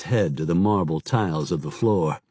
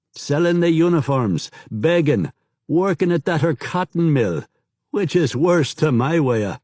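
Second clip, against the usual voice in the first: cheeky beggar, higher and livelier; beggar informant voice; 7 semitones higher, 3 dB louder than usual. A narrator's reading. Beggar telling Raj about the unpaid soldiers, higher and livelier. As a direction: rattle off the gossip like a street informer.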